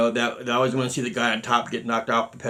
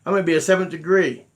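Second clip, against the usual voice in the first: higher and louder, gushing fake delight; mock excited; 5 semitones higher, 5 dB louder than usual. Imagining a student eagerly accepting promotion, mock excited. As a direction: play the overeager student jumping at the offer. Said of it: "I could have said".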